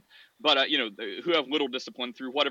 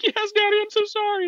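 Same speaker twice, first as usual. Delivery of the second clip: put-on very high, louder child voice; sorry little kid; 7 dB louder than usual. Son apologizes to daddy, high little-kid voice. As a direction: sound like a small boy eager to please.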